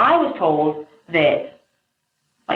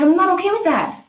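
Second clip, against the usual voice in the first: high-pitched retold protest; freaked-out quote, voice jumping high; freaking out; 14 semitones higher than usual. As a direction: re-enact an alarmed objection, pitched way up. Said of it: "I started, like, freaking out".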